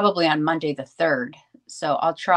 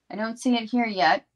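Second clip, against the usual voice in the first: ordinary talk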